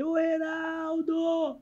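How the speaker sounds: imitated coach calling. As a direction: stretch the name out high, calling him over. Coach calling out player in story. (técnico chamando, agudo e arrastado)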